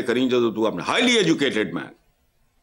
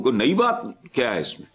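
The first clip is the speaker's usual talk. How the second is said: ordinary talk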